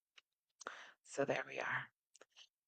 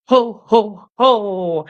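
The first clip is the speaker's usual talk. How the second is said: louder, higher jolly ho ho ho; Santa laugh; 4 semitones higher, 4 dB louder, much slower than usual. Shown as a demonstration. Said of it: "Play Santa".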